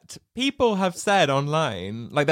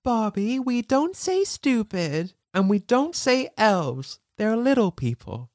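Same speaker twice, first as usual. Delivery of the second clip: prim teacher voice; 6 semitones higher than usual. Retold or imitated. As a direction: primly correct a child, a bit higher. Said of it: "She's like".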